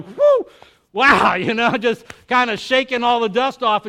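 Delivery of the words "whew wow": a high, loud whew of wonder; relieved amazement. Retold or imitated. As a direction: breathe out in awe, much higher. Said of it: "looking around going".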